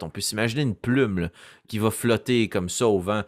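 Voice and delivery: ordinary delivery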